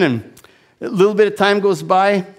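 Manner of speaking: normal talking voice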